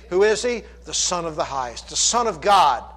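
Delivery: ordinary talk, nothing marked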